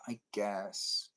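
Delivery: sighed, soft acted reply; reluctant agreement. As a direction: give in with a quiet, low sigh. Acted reluctant reply on road trip.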